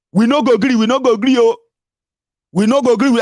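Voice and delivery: loud, high mock rallying cry; imitated crowd-rousing defiance; defiant protest. Voices a leader's expected defiant protest.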